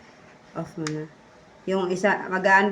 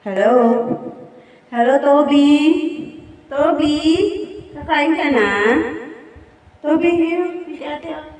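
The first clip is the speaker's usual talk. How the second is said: calling out; 5 semitones higher, 7 dB louder than usual. Real, not acted. Calling the dog Toby loudly.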